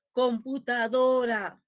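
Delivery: "Computadora" slowed, DO standing out; acento tónico marcado. Shown as a demonstration. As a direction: lean on DO in "Computadora". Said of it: "una sílaba que resalte de las demás". ("Computadora" despacio, resaltando DO)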